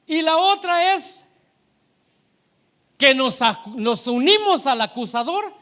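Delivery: impassioned preacher, voice lifted high; full-force preaching. Real, not acted. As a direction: drive the point home loudly.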